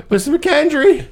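high call broken by a laugh; a mock shout, half laughing; laughing yell. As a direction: call her again, cracking up. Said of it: "Henry yells back".

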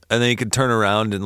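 ordinary delivery

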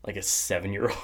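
usual speaking voice

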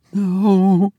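put-on high, trembling young woman's voice; Tessie's timid reply. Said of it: "she said".

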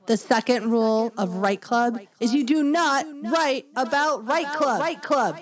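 loud proclamation. Acted. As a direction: bellow it like a rallying cry. Repeats rule, louder, higher.

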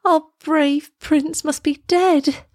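high, drawn-out grief; distressed cry. A narrator's reading. Girl mourns the brave prince, high and distressed. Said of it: "she cried".

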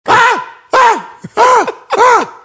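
short, very high screams in a row; high, loud burst of play screaming; mock screams. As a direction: yelp repeatedly as if on fire. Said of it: "Yell like he on fire".

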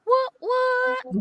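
sudden high squeal of disbelief; amazed. Real, not acted. Host stunned by the guest's achievements, squealing high in surprise.

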